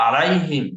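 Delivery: আলাইহিম with a throaty ain, loud; Arabic ain demo. Shown as a demonstration. Teacher reciting আলাইহিম for students, throaty. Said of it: "সূরা ফাতিহার যেটা বললাম আমি".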